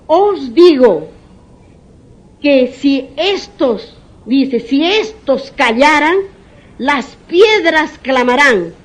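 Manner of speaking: slow, loud preaching, each word weighed; proclaiming scripture. Acted. Preacher proclaiming Jesus' words to the congregation, slow and loud. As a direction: lean into the words like a pulpit proclamation.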